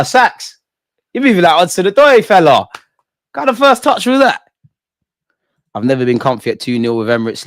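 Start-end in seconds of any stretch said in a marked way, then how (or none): 0.0-4.4 high, loud bursts of hype; excited hype. Real, not acted. Streamer hyping a winger's touch, loud and high. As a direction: gas up the player's first touch.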